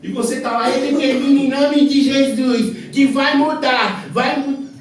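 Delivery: loud, high shouted sermon; fiery preaching. Acted. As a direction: thunder it from the pulpit. Preaching at full force.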